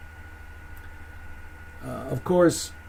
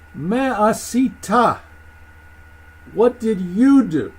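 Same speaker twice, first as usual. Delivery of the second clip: slow, raised accusing question; emphatic, higher-pitched reproach; God confronting Cain; 5 semitones higher, 5 dB louder than usual. Acted. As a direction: weigh out each word as a stern rebuke. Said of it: "God's response".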